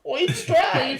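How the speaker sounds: high, squeaky Ben Affleck parody; Ben Affleck parody raccoon. Shown as a demonstration. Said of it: "it sounds like Ben Affleck making fun of himself".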